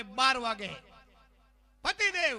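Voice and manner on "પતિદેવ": high, loud comic wife; wife phoning. Retold or imitated. Wife checks on husband by phone, high loud call.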